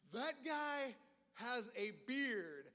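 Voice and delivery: slow, stunned muttering to himself; slow stunned thought; awestruck disbelief. Retold or imitated. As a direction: drag it out, amazed at the beard. Freshman staring at bearded senior.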